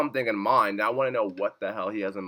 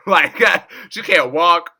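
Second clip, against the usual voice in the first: higher, louder, words breaking into laughter; laughing disbelief; 7 semitones higher, 12 dB louder, slower than usual. Real, not acted. Viewer laughing over the girl with no limbs, laughing disbelief. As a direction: struggle to talk through the laughs. Laughing at grim plot recap.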